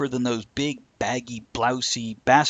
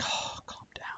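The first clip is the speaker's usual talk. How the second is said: whispered, hushed brush-off; whispered aside; 12 dB quieter than usual. Retold or imitated. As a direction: breathe it out as a hush. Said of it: "It's like".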